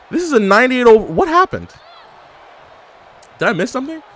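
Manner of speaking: ordinary delivery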